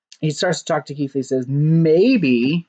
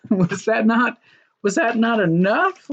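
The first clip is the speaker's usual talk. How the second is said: mock disbelief; 7 semitones higher than usual. Continuing mock exasperation.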